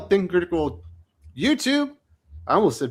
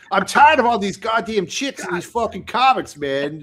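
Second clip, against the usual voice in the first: put-on old Sully; 2 semitones higher than usual. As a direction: rant like a fed-up comics fan, hammed up. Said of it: "I prefer the old Sully. It was like".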